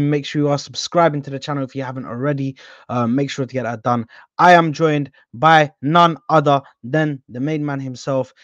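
normal talking voice

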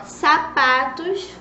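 sapatos drawn out slowly, syllable by syllable, rising at the end; slow spelling-out voice. Shown as a demonstration. Teacher to small children, stretching sapatos out slowly. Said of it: "começa com a letrinha S".

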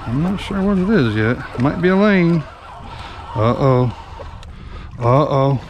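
usual speaking voice

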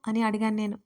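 ordinary delivery

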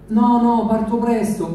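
lightly voiced insistent young woman; girl's voice. Girl voiced in story.

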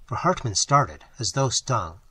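usual speaking voice

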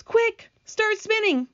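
high, drawn-out urgent order; urgent yell. Acted. James orders the silkworm to spin, urgent yell. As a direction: call it out high, stretching the words. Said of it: "yelled James".

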